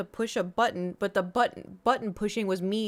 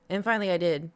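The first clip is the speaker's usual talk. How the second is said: ordinary talk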